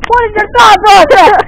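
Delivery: laughing exasperation. Real, not acted. Laughing at a friend's useless advice, loud and high. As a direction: laugh it out in frustration.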